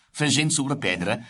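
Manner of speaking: ordinary delivery, nothing marked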